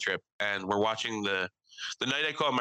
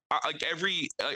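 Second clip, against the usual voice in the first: ordinary delivery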